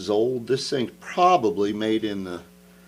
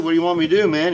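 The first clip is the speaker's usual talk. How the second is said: loud, high mock outburst at his buddy; hammed-up what-do-you-want shout; exasperated retort; 6 semitones higher, 10 dB louder, much faster than usual. Retold talk with best buddy.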